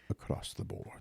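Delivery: slow dramatic whisper; whispered title. Acted. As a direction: breathe the show name slowly.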